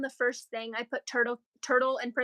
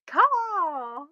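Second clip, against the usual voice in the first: hawk screech; 5 semitones higher, 4 dB louder than usual. Shown as a demonstration. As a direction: echo the hawk cry a little lighter. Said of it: "That's what it is".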